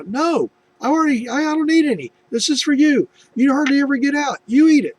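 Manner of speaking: high, loud, pleading insistence; insistent refusal. Retold or imitated. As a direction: press the food back on her. Insisting wife keep the barbecue.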